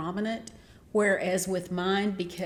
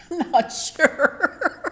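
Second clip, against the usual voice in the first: laughing; 9 semitones higher, 6 dB louder, much slower than usual. Real, not acted. Laughing at own bad French.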